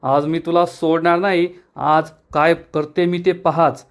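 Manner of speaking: ordinary delivery, nothing marked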